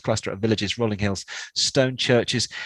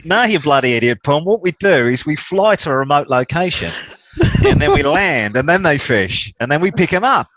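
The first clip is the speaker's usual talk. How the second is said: louder, higher put-on Australian woman; 5 semitones higher, 5 dB louder than usual. Queensland woman scolding the naive Pom, in an Australian accent. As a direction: scold the Pom in an Aussie twang. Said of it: "And she said".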